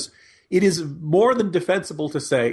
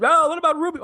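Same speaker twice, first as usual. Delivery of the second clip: much higher, louder sneering mock question; mocking imagined critic; 12 semitones higher, 4 dB louder, faster than usual. Pundit mocking critics who deflect to Rubio, high squeaky mock voice. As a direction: toss off the objection in a squeaky mock voice.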